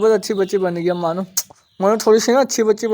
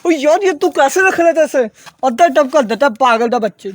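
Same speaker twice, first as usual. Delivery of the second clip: exasperated yell, higher and louder; loud exasperated scolding; 5 semitones higher, 6 dB louder than usual. Real, not acted. Owner scolding a pigeon for knocking feed over, loud exasperated yell. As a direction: raise the voice high and loud at a messy pigeon.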